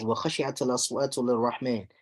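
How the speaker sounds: quick, flowing recited verse; quick tajweed recitation; Quran recitation. Shown as a demonstration. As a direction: chant the ayah fast in one breath.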